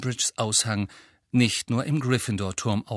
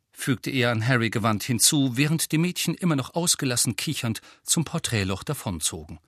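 ordinary delivery, nothing marked

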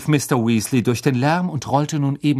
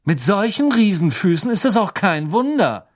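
put-on sneering rich boy; 8 semitones higher, 5 dB louder than usual. A narrator's reading. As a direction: look down on them and enjoy it. Malfoy sneering from a tree.

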